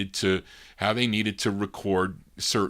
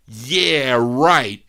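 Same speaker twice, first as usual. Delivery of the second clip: loud, punched, dismissive mimicry; disrespectful; 4 semitones higher, 9 dB louder than usual. Son showing how his father really said it, dismissive. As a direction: throw it back scornfully, hard and loud. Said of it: "That's not how you say it. You say".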